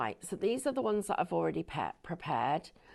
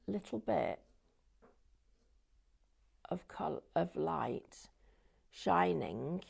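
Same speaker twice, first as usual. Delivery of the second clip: hushed wonder; slower than usual. Acted. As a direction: hush your voice to admire it. Describing soft light on design.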